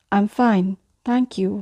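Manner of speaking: ordinary delivery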